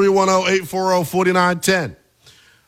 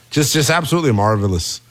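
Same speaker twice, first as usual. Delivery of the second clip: ordinary talk, nothing marked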